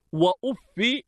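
وأف said "wa-uffi", short i after doubled f; kasra on fa. Shown as a demonstration. Teacher showing students وأف as "wa-uffi", fa with kasra. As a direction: end وأف on "-fi". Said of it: "بكسر الفاء".

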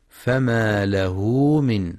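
فما له من with a lengthened hu; slow recited فما له من, long hoo; minor silah madd. Shown as a demonstration. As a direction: draw out the u of له before من. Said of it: "لمد الصلة الصغرى".

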